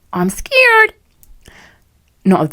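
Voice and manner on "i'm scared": squeaky high frightened whimper; mock scared. Among British strangers, mock frightened squeak. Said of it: "I'm like".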